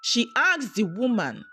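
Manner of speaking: plain reading voice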